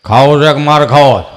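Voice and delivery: low, loud threatening father; stern grandpa. Acted. Grandpa standing over the kids with a blunt threat. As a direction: growl one blunt threat. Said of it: "ખાલી એટલું જ કહે".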